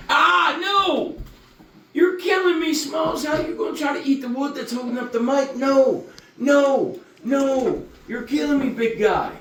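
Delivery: put-on high-pitched baby talk to a dog; doting pet scolding. Owner telling his big dog not to eat the mic, high playful scolding.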